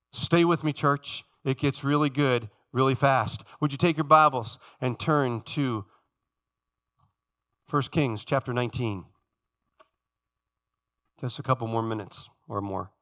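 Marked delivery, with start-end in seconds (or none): none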